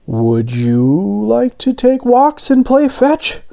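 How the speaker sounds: light up with a sudden idea; Mister Bing proposing to his new donut dog, excited; excitedly. A narrator's reading. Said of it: "Mister Bing said excitedly".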